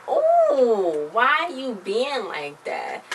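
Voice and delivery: high, slow, drawn-out "why you being like that"; mock disbelief. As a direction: stretch it out, teasing and baffled. Narrator mocks character's attitude.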